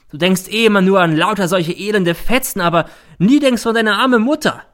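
high, loud hysterical reproach; increasingly hysterical. A narrator's reading. Mother reproaching her son, hysterical. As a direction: wail the accusation in fury. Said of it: "wird immer hysterischer".

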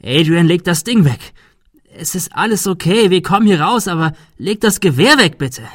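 high, loud, pressing appeal; urgent plea. A narrator's reading. Thomas begging Adrian to put the rifle away, urgent. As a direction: talk him down urgently. Pleading with an armed friend.

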